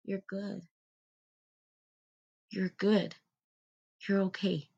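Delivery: soft, comforting self-talk; tender reassurance. Acted. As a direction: soothe your inner child softly. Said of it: "hold my hands to my heart and say".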